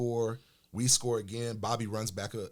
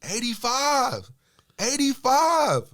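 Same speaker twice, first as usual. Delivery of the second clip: heckling yell; 11 dB louder, much slower than usual. Retold or imitated. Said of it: "starts yelling at me".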